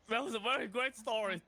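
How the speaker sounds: breaking up in laughter mid-line; can't keep a straight face; laughing. Real, not acted. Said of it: "Sorry, let me compose myself".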